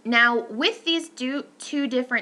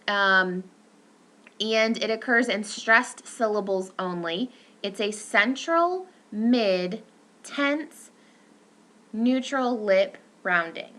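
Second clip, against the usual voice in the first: ordinary talk, nothing marked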